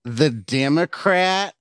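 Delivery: Democrat drawn out slowly like a slur; the Democrat, stretched slow and scornful; sneered Democrat. Retold or imitated. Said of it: "Gotta say Democrat".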